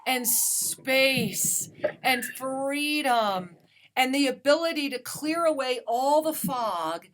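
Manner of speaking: soothing healer guiding a caller to breathe; guided breathing. Acted. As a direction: gently lead the caller through her breaths.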